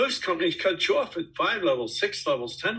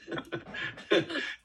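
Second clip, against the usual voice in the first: breathy laugh; 6 dB quieter than usual. Real, not acted. Laughs after own punchline.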